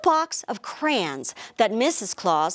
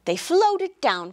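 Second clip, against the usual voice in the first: ordinary delivery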